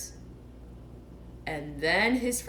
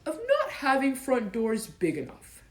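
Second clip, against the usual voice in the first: cross; 5 semitones higher than usual. Acted. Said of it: "said Pooh crossly".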